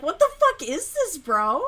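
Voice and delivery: high, loud disbelieving yell; retold outburst. Retelling her reaction to the channels, loud and high. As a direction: shout it in disbelief. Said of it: "and I'm like".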